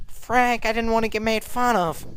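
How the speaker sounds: about to cry. Acted. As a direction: whimper it, half-hearted. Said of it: "she was about to cry".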